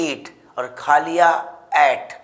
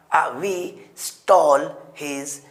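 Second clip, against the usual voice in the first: ordinary delivery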